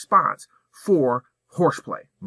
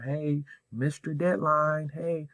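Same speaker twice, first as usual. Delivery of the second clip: passive email tone; 4 semitones higher, 6 dB quieter, slower than usual. Shown as a demonstration. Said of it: "It's a little passive or".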